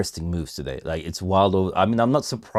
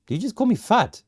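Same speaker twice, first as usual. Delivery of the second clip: mock outrage; 12 semitones higher than usual. Chat teases him as fat.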